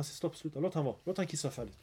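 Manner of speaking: soft, patient voice of the Prophet; gentle calm. Retold or imitated. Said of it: "Han lärde honom fint".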